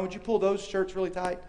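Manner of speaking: ordinary delivery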